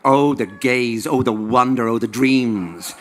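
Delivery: loud, slow, swooning oh the gaze; hammed-up rapture. Hammed-up rapture over boy.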